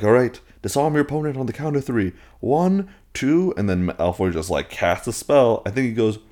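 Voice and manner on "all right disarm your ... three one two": duel countdown call. Retold or imitated. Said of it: "then they're like".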